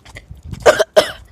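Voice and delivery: harsh unvoiced cough; coughing fit. Real, not acted. Choking on her spit, she coughs hard. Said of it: "choked on my spit".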